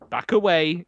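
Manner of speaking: ordinary delivery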